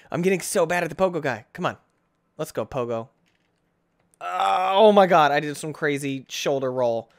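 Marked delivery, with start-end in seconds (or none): none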